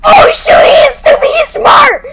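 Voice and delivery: high frantic shouts; panicked shouting. Real, not acted. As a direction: shout while fleeing in panic. Monster chasing, yelling.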